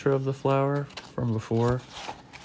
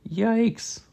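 mock shout; 12 semitones higher than usual. A narrator's reading. Arnold sees bees heading for the flower, mock shout. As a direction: yelp it as a storybook shout. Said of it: "shouted Arnold".

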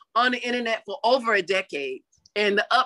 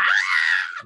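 excited scream. Retold or imitated. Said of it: "I was just too excited".

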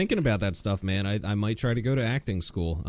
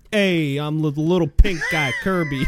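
Kirby; 6 semitones higher, 6 dB louder than usual. Acted. Imitating an online comic's mascot impression, loud hammed-up squeak. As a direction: belt it out, then squeak the name. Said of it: "I got the best impression of Kirby".

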